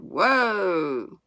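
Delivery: mild drawn-out calling halt; shouted halt. A narrator's reading. Boy stopping calves at the road, mild long shout. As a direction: call a long, contained halt. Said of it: "They stopped when he shouted".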